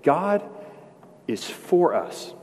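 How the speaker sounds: God lifted high, then is for us slowed; slow emphasis. Shown as a demonstration. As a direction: lean on God, let it soak in. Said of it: "You ought to let that soak in".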